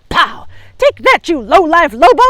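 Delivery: loud, high fierce shout. Acted. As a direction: shout it while shooting. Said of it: "she shouted".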